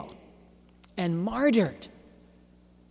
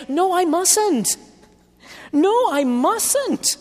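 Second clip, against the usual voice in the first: slow, higher, emphatic refusal; firm refusal; 13 semitones higher, 4 dB louder, slower than usual. Retold or imitated. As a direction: drag out each "No, I mustn't" with weight. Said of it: "And I say".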